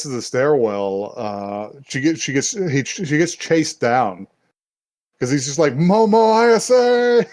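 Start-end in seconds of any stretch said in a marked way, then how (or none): 5.7-7.3 high, loud, drawn-out name call. Retold or imitated. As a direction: holler the name slowly after someone. Retold chase, calling after her.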